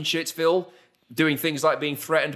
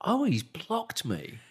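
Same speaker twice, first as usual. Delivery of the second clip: quiet realization; 2 semitones higher, 7 dB quieter, slower than usual. Retold or imitated. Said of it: "So I'm like".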